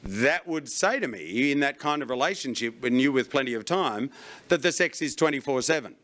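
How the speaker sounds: low, quiet skeptical punter; low skeptical audience member. Retold or imitated. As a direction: mutter it like a knowing heckler. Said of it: "You're going".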